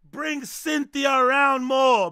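loud hype. Real, not acted. Loud hyped demand, slowed.

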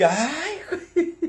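high laughing ay güey; amused laugh. Real, not acted. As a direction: burst out laughing at them. Laughing at the critics.

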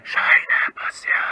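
whispered and distant, as if calling up from a well; faint far-off whisper from a well; from the bottom of a well. Shown as a demonstration. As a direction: call the name faintly from deep down a well.

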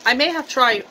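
talking right after a sip; sipping a drink. Real, not acted. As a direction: cup at the lips. Seen 3: Cup held to lips sipping at start, lowered by 1.0s.

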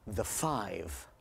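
low, soft, unhurried punchline; deadpan answer. Retold or imitated. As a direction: drop it quietly and let it land.

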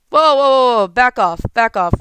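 loud alarmed whoa, then barked commands; raging gamer. Real, not acted. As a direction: shout at the attacker to back off. Enemy rushes him in game.

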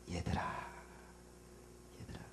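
soft whispered tender call; gentle whispered call to the disciples; Jesus' loving voice. Acted. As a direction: breathe it like calling a beloved child. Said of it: "이게 사랑의 주님의 음성".

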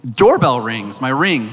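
ordinary delivery

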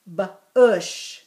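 ordinary talk, nothing marked

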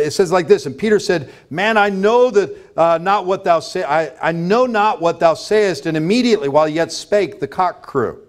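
ordinary talk, nothing marked

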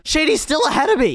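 high, loud incredulous yell; outraged disbelief. Real, not acted. Player yelling that a rival leads, outraged. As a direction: cry out in disbelief at falling behind.

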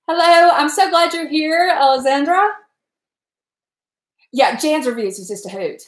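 normal talking voice

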